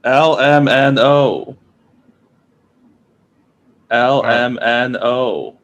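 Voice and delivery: drawn-out careful letters; slow spelling. Shown as a demonstration. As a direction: spell each letter slowly. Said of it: "do it again".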